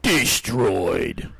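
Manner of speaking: deep, loud game-announcer boom. Pretend. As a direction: declare the kill in a low boom. Mock game announcer call.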